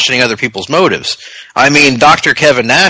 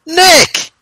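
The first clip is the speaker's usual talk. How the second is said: dramatic high. Acted. High, dramatic name reveal.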